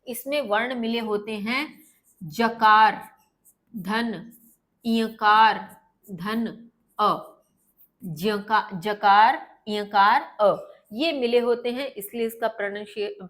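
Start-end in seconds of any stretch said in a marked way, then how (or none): 4.9-10.7 ईयकार धन अ drawn out, each sound apart; spelled out slowly. Shown as a demonstration. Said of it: "इसका pronunciation होता है".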